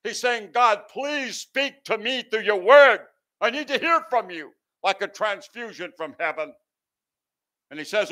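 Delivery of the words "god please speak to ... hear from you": desperate plea. Acted. Preacher voices the psalmist's desperate prayer to God, pleading. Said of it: "he's crying out from desperation".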